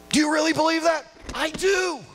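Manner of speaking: high, ringing preacher's cry; emphatic declaration. Real, not acted. Pastor affirming God is on the throne, high fervent declaration. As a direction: proclaim your conviction to the whole room.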